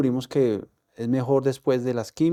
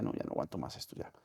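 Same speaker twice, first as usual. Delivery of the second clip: breaking down; 5 semitones lower, 13 dB quieter, faster than usual. Retold or imitated. Crying on phone to mother.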